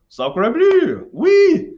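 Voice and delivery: hammed-up French accent, loud and high; excited mock French exclamation; Napoleon. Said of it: "And of course, France and Napoleon".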